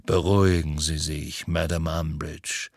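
low, unhurried calming voice; deep, slow. A narrator's reading. Kingsley calms Umbridge down, deep and slow. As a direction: sink deep and slow right down. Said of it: "mit seiner tiefen, langsamen Stimme".

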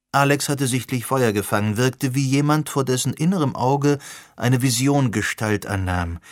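usual speaking voice

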